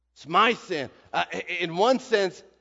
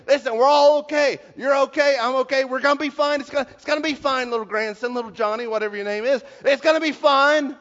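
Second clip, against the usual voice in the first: higher, louder, frantic soothing; poured on reassurance; 5 semitones higher, 4 dB louder, faster than usual. Retold or imitated. The teacher frantically reassuring his grandson on the boat, higher and louder. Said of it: "so I poured it on again".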